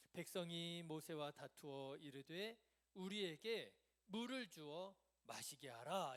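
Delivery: loud, slow, measured reading; scripture reading. Real, not acted. Preacher leading the congregation in the verse, loud and deliberate. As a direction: read it out strongly for all to follow.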